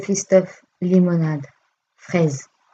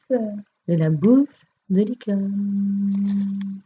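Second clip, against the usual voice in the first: very slow, drawn-out reveal; grand announcement; 2 semitones higher, much slower than usual. Shown as a demonstration. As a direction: stretch it out like a big unveiling. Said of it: "j'ai envie de vous présenter".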